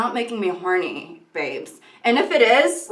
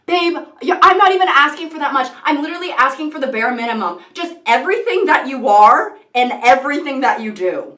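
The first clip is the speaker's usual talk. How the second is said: higher, louder and fast exasperated plea; mock argument; 4 semitones higher, 7 dB louder than usual. Arguing with a partner, a louder fast exasperated plea. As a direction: argue it quickly and loudly. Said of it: "getting in an argument with your significant other and being like".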